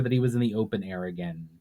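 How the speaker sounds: normal talking voice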